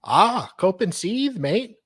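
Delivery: high-pitched attempt at Australian; Australian accent. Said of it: "maybe he's an Australian".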